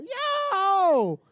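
high loud yell; dazed patient hollering to fifty people. Retold or imitated. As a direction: bellow it out. Yelling in lobby.

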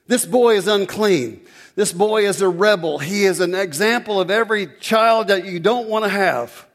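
loud, high preaching at full force; full-throated revival preaching; fiery preaching. Acted. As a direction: preach it out to the back row.

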